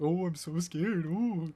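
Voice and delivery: mocking fear in a haunted house; goofy mock scared. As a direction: tease the scary guy, hammed up. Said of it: "I was laughing because I was like".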